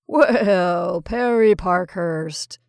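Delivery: loud, drawn-out exclamation of amazement; amazed. A narrator's reading. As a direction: recognise him in astonishment. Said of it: "he exclaimed in amazement".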